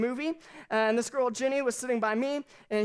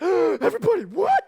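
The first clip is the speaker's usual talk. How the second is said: higher, much louder shriek of alarm; horrified shout; 7 semitones higher, 8 dB louder than usual. Retold or imitated. Churchgoer told to hold hands, shrieking much louder in horror. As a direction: yelp it like you were told to touch germs.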